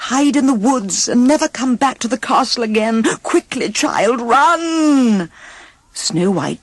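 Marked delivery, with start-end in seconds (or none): 0.1-5.3 urging huntsman. A narrator's reading. Huntsman urging her to flee.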